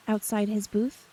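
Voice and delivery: plain reading voice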